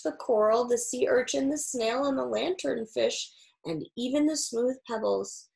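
plain reading voice

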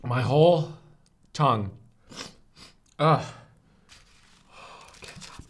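talking with mouth full; words round a mouthful. Real, not acted. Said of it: "talk with my mouth full of food".

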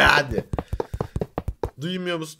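ordinary talk

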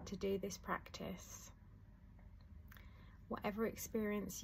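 soft, unhurried meditation voice with long pauses; guided meditation. Acted. Teacher guiding listeners through a letting-go meditation, soft and calm. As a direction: ease the listener gently into calm.

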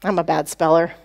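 low-pitched resigned self-verdict; acted self-deprecation, pitched low; dejected inner voice. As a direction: drop low, as if repeating an old belief. The message in his head.

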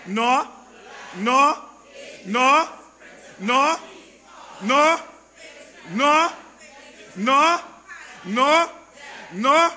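loud, separate, rising cries; preacher's repeated emphatic shout; shouted refusals. Real, not acted. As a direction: shout each word as its own blast. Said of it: "Shout it out".

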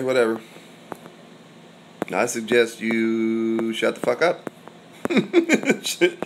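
ordinary talk, nothing marked

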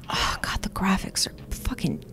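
whispering. Real, not acted. Awed by the game graphics.

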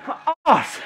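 straining, breathless yells mid-effort; cycling hard. Real, not acted. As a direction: push through burning legs, loud and high. Straining near end of FTP test.

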